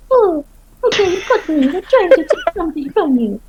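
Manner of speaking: high, hammed-up caving-in voice; mock confession. Huntress mocking people who confess to the queen, high pitched. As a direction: overplay the guilty people. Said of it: "people are like".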